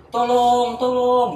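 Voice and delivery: high, loud drawn-out cry for help; long, wailing yell; screaming for help. Retold or imitated. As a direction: re-enact a desperate scream. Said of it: "gue teriak-teriak terus".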